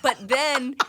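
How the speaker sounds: hammed-up know-it-all boast; cocky younger self. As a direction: brag it up, mocking your own old swagger. Mimics her cocky younger self.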